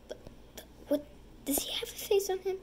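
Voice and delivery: hushed, breathy whisper. Real, not acted. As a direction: whisper it in close wonder. Peering closely at a worm.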